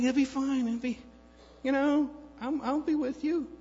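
high, light mock reassurance; lightly voiced sweet talk to wife; reassuring. Husband telling worried wife it'll be fine, high and light.

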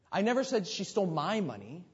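"my" punched high; emphasize "my" above all other words; stress on MY. Shown as a demonstration. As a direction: hit the word "my" hardest. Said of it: "emphasize a different word".

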